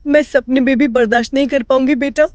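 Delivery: crying plea, voice breaking; रोती हुई विनती. Acted. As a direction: beg through tears not to be left alone. सास रोते हुए बहू से विनती. (रोते हुए गिड़गिड़ाना)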